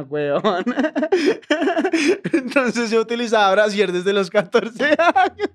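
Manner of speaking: words broken by high real laughter; confessing the bra story through real laughter; laughing confession. Real, not acted. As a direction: spill it while cracking up.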